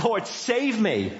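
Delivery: high, slow, pleading cry out; desperate cry to God. Retold or imitated. Preacher voicing the psalmist crying out to the Lord, high slow plea. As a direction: call out as if down on your knees with nothing left. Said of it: "And he just cries out".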